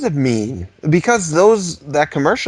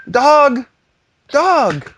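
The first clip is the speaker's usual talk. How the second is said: high, loud call to the dog; 11 semitones higher, 5 dB louder than usual. Real, not acted. Calling the dog to come, high and loud. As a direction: shout for the dog across the house. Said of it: "I can't whistle very well".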